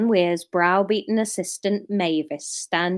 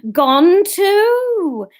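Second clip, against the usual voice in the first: Kenneth Williams voice; 10 semitones higher, 7 dB louder than usual. Acted. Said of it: "Kenneth Williams".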